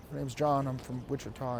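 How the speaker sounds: quiet mumbled reply. Retold or imitated. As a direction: still too soft. Student repeats, still mumbling.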